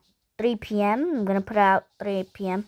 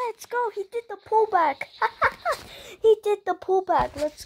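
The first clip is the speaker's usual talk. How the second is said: high-pitched excited cheer; 11 semitones higher than usual. Real, not acted. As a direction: cheer him on, thrilled. Celebrating teammate's move.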